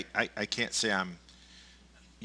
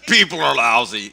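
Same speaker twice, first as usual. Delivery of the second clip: mock cynic; 12 dB louder than usual.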